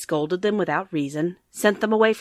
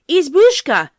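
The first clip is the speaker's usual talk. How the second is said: put-on higher, even louder childish call; children calling louder; 10 semitones higher, 12 dB louder, slower than usual. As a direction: call again, higher and harder. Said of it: "shrill, childish voices they called out loud".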